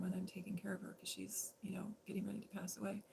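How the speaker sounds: whispered softly, falling away; hushed whisper. Real, not acted. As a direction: drop to a whisper for the sad part. Speaking of dying partner.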